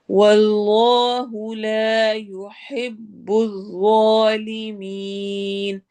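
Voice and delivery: slow melodic tajweed chant; measured Quranic recitation. Shown as a demonstration. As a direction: recite slowly, stretching the long vowels. Recites verse phrase.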